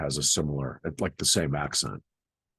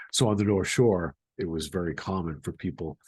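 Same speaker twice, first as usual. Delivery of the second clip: ordinary talk, nothing marked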